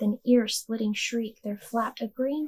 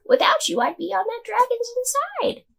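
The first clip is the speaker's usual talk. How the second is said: grateful cry. A narrator's reading. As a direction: exclaim it with relief. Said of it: "the grateful witch cried".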